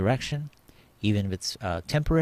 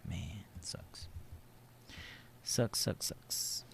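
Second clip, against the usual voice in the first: disappointed whisper; 11 dB quieter, slower than usual. Real, not acted. Stock heading lower, disappointed.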